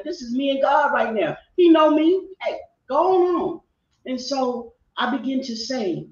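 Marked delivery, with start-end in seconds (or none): 0.0-3.6 real tears breaking through raised, pleading words; crying out. Real, not acted. Telling nosy people to leave her faith alone, crying. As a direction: speak through real crying, voice lifted and unsteady. Testifying about God, crying.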